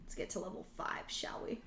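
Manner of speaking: breathy secret whisper to the camera; hushed playful aside. Real, not acted. Conspiratorial aside to viewers.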